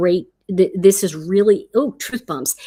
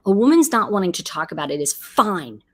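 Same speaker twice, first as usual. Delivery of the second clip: ordinary delivery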